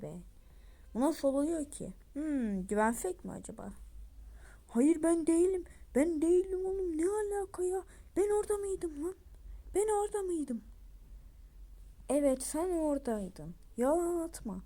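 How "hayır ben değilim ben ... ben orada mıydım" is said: high, indignant protest; accused crewmate protesting his innocence; defensive denial. Acted. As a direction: insist you weren't there, voice up high. Accused character denies it.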